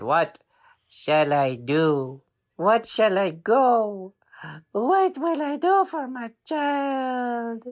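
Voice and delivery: a mother's helpless lament, rising high; desperate mom. Acted. Mother despairing over her child who won't get up, slow rising wail.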